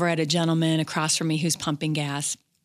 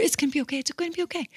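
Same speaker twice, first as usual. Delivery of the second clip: higher, fading softly into reassurance; comforting the crying doctor, getting softer; tender reassurance; 9 semitones higher, 4 dB quieter, much faster than usual. Retold or imitated. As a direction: soothe her as she cries. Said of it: "I just kept reassuring her".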